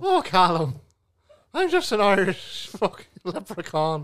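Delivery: teasing Callum in a mock Irish accent. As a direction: ham up an Irish lilt at Callum. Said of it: "spoke in an Irish accent".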